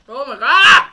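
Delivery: loud pained exclamation; loud cry. Real, not acted. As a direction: cry out at the burn. Reacting to heat.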